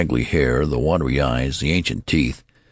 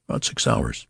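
hushed, breathy careful reply; anxious father answering the clerk under his breath; considered answer; 6 semitones lower, 5 dB quieter than usual. A narrator's reading. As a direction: weigh each word and keep it soft. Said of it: "with due consideration".

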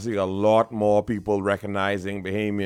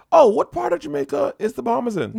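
very high-pitched imitated question; hammed-up naive curiosity; mock clueless questioner; 4 dB louder than usual. Mimicking clueless Toronto question.

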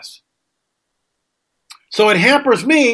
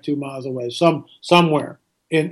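ordinary talk, nothing marked

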